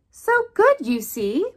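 ordinary delivery

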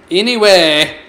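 a loud, overdone drawn-out anyway; ridiculous anyway. Real, not acted. Playing the game, a silly overdone anyway. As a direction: ham up the word Anyway. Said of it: "I said that ridiculously".

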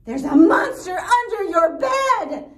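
loud, high alarmed cry. Acted. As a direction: blurt the warning in fright. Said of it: "cried Pig".

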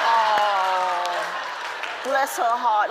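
a hint of a pitying onlooker, drawn out; mock sympathy from a bystander; lightly voiced tut-tutting onlooker. Imagined onlookers pitying her.

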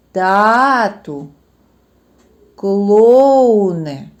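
loud drawn-out calling of each word. Shown as a demonstration. Teacher calling words aloud to find the stress. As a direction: call each word as if summoning it from afar. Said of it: "треба слово покликати".

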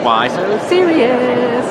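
high, slow, drawn-out Joker line; Joker impression. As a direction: stretch it out like the Joker. Quoting the Joker.

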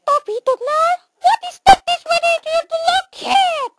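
tiny, very high-pitched mouse squeak. Acted. Mouse character questioning Toby, very high squeaky mouse voice. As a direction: talk like a sped-up cartoon mouse. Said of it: "no more mouse voice".

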